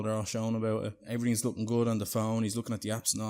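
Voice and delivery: ordinary delivery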